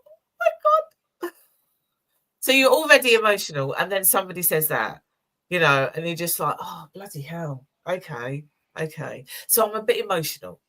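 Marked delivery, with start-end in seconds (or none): 0.0-1.4 high, slow, tearful squeak; overwhelmed by a client's thanks, voice cracks; choked-up cry. Retold or imitated. Moved by her client's words, tearful high cry. 6.6-9.2 softer, steadying herself; talking herself down from tears; quiet overwhelmed. Retold or imitated. To herself after the client's thanks, softly overwhelmed. Retold overwhelmed self-talk.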